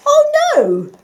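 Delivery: very high, loud wail. A narrator's reading. Fox sees his old rubbish returned, wailing. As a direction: cry out in horror. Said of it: "wailed Fox".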